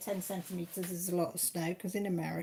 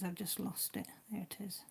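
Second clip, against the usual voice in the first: whispering; 8 dB quieter than usual. Real, not acted. Muttering to herself over puzzle.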